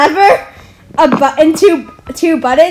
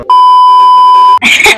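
high laugh; 6 dB louder than usual. Real, not acted. Big laugh at blooper talk.

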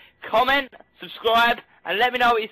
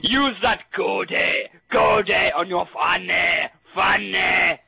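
very slow, drawn out goade; 2 semitones lower, much slower than usual. Pretend. Gamer teasing friends, saying goade very slowly. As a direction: stretch goade on your fanny out slowly. Mock French word.

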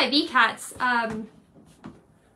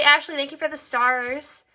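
usual speaking voice

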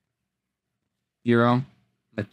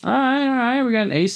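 excited; 10 semitones higher, slower than usual. Real, not acted. Pulls a good card.

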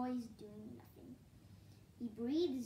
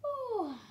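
loud, high yawning "oh"; high yawn sliding downward; big yawn; 11 semitones higher, 10 dB louder than usual. A narrator's reading. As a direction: let out a big, noisy yawn. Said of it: "he gives another big yawn".

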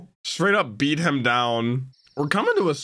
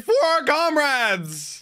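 mock battle cry; 10 semitones higher, slower than usual. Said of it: "They're like".

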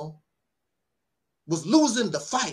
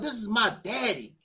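ordinary talk

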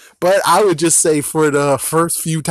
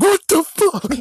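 shocked exclamation. Retold or imitated. A bystander seeing a gun appear, shrieking very high in shock. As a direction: squeal it in total disbelief. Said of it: "it's like".

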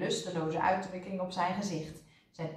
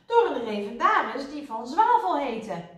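high, loud defensive explanation; crying out; 7 semitones higher, 6 dB louder than usual. A narrator's reading. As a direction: nurse protesting in self-defence. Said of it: "riep zuster Snijwiet". (verdedigend uitroepend)

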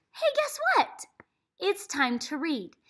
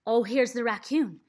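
ordinary talk, nothing marked

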